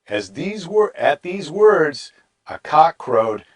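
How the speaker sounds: ordinary talk, nothing marked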